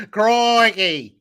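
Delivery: high, loud Aussie exclamation; mock Australian accent. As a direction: stretch it out in hammed-up Australian. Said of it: "You're fucking Australian".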